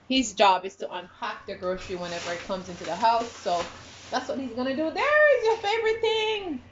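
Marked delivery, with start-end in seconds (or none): 4.9-6.6 excited. Real, not acted. Excited to son.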